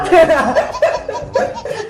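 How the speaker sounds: high run of giggles; high, mischievous laughter; giggling. Acted. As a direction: giggle at someone's fright. Laughing at the frightened neighbour.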